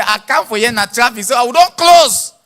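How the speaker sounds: high shout, slowing at the end; building mock-indignant shout; acted loud protest. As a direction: protest loudly as an aggrieved customer. Acts out customer's protest at bank.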